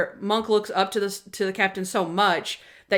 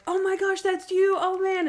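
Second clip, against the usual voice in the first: delighted reunion; 10 semitones higher than usual. Retold or imitated. Buddies reunite.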